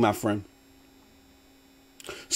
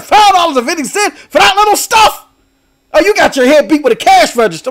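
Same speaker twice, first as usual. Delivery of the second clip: coworker mock outrage; 8 semitones higher than usual. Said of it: "She's like".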